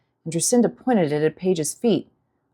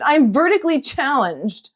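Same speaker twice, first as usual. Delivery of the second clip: indignant shout; 7 semitones higher, 3 dB louder than usual. A narrator's reading. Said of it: "Dewey shouted".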